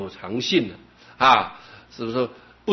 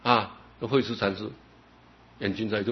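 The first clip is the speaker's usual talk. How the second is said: normal talking voice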